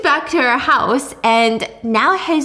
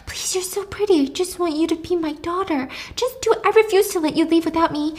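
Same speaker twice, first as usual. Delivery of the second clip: high, needy mother's voice; clingy pleading; 6 semitones higher than usual. Acted. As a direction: beg her in a small, high voice. Clinging mother begs Jules.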